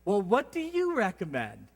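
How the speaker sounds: high mock-wife voice; hammed-up question to a waiter; Monica. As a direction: swoop up high, then fall away. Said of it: "She goes".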